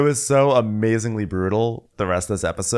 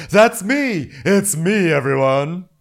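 loud, high, eager shout; mock excited outburst; 9 semitones higher, 5 dB louder, slower than usual. Someone claiming to be John's type, mock excited shout. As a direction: burst in claiming it loudly.

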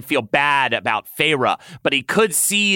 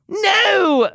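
much higher, louder moaning no; moaned no; 5 dB louder than usual. Acted. Tamlin begging Lucien not to stop, moaning. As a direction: cry it out, desperate. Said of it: "moaned Tamlin".

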